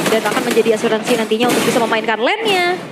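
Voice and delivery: ordinary talk, nothing marked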